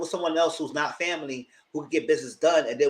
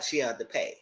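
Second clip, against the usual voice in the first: ordinary talk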